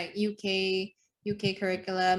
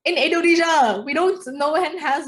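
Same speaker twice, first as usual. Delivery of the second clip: louder, higher insistence; loud retort; 7 semitones higher, 9 dB louder than usual. Real, not acted. Friend telling Candy why, loud and high. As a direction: push back hard on her.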